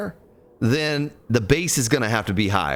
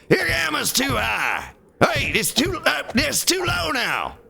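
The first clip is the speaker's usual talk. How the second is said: angry Texan voice. Retold or imitated. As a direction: bark it like a cranky Texan, slow and much higher. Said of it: "The angry Texan voice is amazing".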